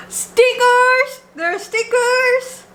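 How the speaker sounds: high, thrilled squeal of discovery; delighted. Real, not acted. Discovering stickers in the gift.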